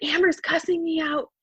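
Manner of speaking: high, pleading yell at mom; retold upset scream. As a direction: scream it out, enraged. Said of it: "I'm just, like, screaming".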